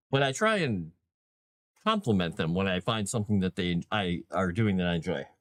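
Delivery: normal talking voice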